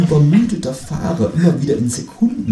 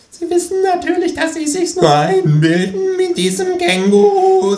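shrill psychiatrist; 5 dB louder than usual. Acted. Psychiatrist lecturing his patient, very high shrill voice. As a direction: shriek it out like a pompous doctor. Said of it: "sagt der Psychiater schrill".

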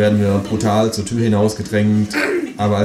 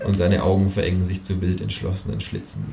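usual speaking voice

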